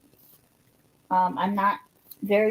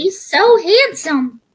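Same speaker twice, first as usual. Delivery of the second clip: cooing; 9 semitones higher, 7 dB louder, slower than usual. Real, not acted. Cooing over a friend's cat.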